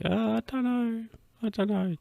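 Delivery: high, whiny waver of doubt; mock uncertainty. Uncle unsure about his title, mock dithering.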